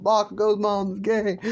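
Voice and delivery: high, held-up singsong exclamation; shocked realizing. Acted. Whole class seeing who is in bed, high shocked call. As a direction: announce the discovery in a high voice. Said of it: "they're not making fun, they're just, like, realizing".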